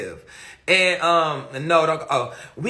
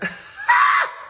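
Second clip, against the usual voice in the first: burst of laughter. Real, not acted. Mocking the Leo sweetness claim.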